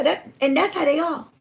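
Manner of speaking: ordinary delivery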